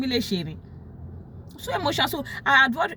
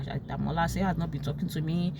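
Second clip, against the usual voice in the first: ordinary talk, nothing marked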